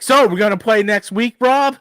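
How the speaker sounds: mock chirpy question. Geeky Guy cutting in while he pours out his heart, chirpy and high. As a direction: chirp it like an oblivious chat buddy. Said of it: "Geeky Guy's like".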